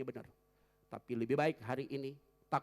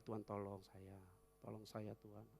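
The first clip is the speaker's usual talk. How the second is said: soft, low murmured plea; quiet inner prayer; 5 semitones lower, 10 dB quieter than usual. Shown as a demonstration. Pastor showing a calm prayer in the heart, soft. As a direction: pray softly as if in your heart. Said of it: "Biasa aja dalam hati".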